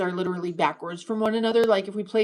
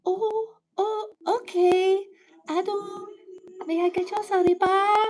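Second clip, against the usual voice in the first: mock Japanese waitress; 10 semitones higher than usual. Streamer acting a waitress offering sarepa, high mock voice. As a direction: play a cutesy waitress taking an order.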